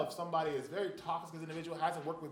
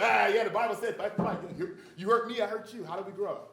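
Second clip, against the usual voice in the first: loud, higher voice with a laugh in it; mock know-it-all; 4 semitones higher, 7 dB louder than usual. People misquoting scripture, mocked as loud and smug. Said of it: "They say".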